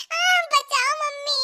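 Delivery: high, drawn-out cry for help; panicked wail. Acted. Chased friend calls for mummy, panicked wail. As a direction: wail like a terrified child, hammed up.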